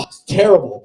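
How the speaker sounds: normal talking voice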